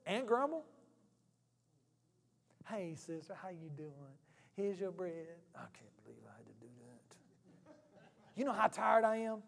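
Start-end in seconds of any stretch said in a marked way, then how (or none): 2.7-5.4 grudging mock greeting. 5.6-7.2 whispered grumble under the breath; muttered aside. Retold or imitated. Church greeter whispering a grumble after serving bread.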